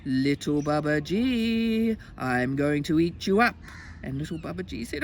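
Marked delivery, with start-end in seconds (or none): 0.1-3.6 stretched-out, slow storybook threat; menacing tiger. Acted. Tiger to little boy he means to eat, slow menace.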